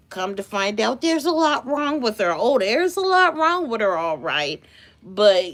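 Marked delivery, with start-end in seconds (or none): none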